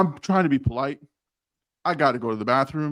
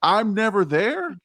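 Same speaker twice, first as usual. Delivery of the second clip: high, fast, incredulous comeback; indignant retort; 10 semitones higher than usual. Retold or imitated. Answering HR's complaint, fast and indignantly high. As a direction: fire it back fast and high, fed up.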